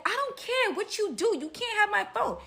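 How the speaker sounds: high, mouthy comeback; imitated defiant refusal. Storyteller voices woman refusing to give her phone, high defiant protest. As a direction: throw the refusal back, pitch way up.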